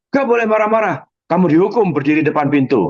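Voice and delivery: high, lightly stern teacher; scolding teacher. Retold or imitated.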